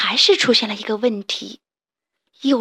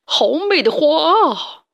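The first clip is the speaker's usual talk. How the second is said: tiger voice; 5 semitones higher, 4 dB louder, slower than usual. A narrator's reading. Tiger admiring flowers.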